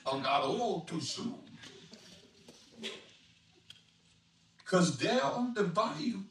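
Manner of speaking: rollicking Cajun rhyme, rising in pitch; Cajun dialect reading. Acted. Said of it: "an expert on Cajun dialect".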